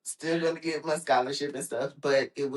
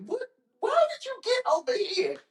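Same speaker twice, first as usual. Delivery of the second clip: much higher, astonished demand; shocked question. Retold or imitated. Brother asking how he got there first, high and shocked. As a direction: act stunned someone beat you there.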